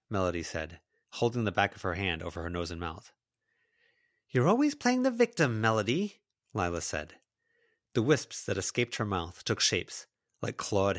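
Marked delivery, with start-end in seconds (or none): 4.3-6.2 high-pitched accusing voice; angry accusation. Acted. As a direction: accuse her, pitched well up. Lila accuses Melody in a fight.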